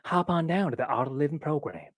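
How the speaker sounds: exaggerated celebrity accent for a parody ad; Matthew McConaughey. Vlogger spoofing the Art of Living commercial, drawling accent. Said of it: "I made fun of Matthew McConaughey's".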